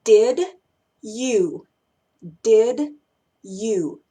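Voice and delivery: "Did ... you" as two isolated words, pause between; separate words. Shown as a demonstration. Teacher giving the first example, words uncombined. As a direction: say "Did" and "you" apart, each one fully.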